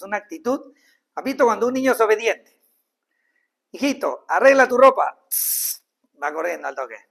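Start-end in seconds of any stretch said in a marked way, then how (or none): 3.7-5.2 put-on a loud call of a parent to a child; parent ordering child. Padre manda al niño. (padre que llama fuerte al hijo)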